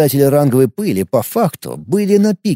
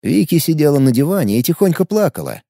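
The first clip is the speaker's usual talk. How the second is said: usual speaking voice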